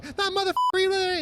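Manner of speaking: cussing in a high put-on rasp. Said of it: "[name] goes from going".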